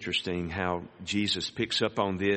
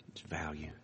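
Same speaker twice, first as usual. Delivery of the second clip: whispered; 5 semitones lower, 13 dB quieter than usual. Shown as a demonstration. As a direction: whisper "value" without voice, very soft.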